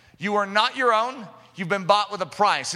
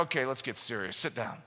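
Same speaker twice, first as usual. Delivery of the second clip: king's orders; 7 semitones lower, 8 dB quieter than usual. Acted. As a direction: lower and softer, a hint of a commanding king. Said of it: "he's going".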